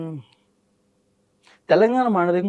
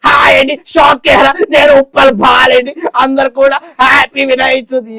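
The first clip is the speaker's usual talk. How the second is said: slow, loud, higher shouted greeting; comic actor shout; 6 semitones higher, 8 dB louder than usual. Pretend. Mimic shouts festival greetings as a comic actor, slow and loud. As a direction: bellow the festival wishes slowly.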